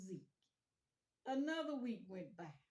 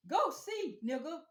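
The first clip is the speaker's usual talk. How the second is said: very high loud shout; loud retort; 8 semitones higher, 11 dB louder, slower than usual. Retold or imitated. Zeke shouting at the boy to go ask himself. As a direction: yell it back, dismissive.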